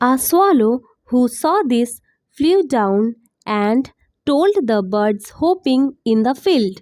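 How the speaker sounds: very slow, separated English words; slow teaching pace. Shown as a demonstration. Teacher reading story line to learners, very slowly. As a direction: model each word slowly for copying. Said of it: "অনেক আস্তে আস্তে".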